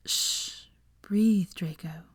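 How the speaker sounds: calm him in a whisper. A narrator's reading. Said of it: "whispered Granger".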